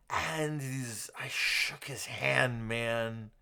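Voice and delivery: put-on slow, slurring drunk rambling. Shown as a demonstration. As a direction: ramble like a friendly drunk buddy. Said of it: "My drunk voice?".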